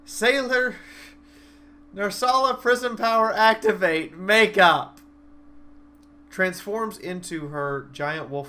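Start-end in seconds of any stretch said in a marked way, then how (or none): 0.0-5.0 loud, high transformation call; magical girl catchphrase. Retold or imitated. Wolf goddess powers up before the sandworm, loud high anime call. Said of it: "Does her hilarious power-up catchphrase".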